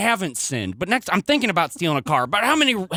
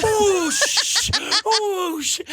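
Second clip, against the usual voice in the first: high startled gasping stammer; shocked; 14 semitones higher, much slower than usual. Acted. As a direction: gasp in disbelief.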